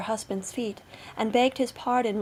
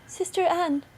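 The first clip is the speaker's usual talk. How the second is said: high voice calling a sister; wife calling out; 8 semitones higher, 3 dB louder than usual. A narrator's reading. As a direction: call out to your sister. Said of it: "she called out to her sister".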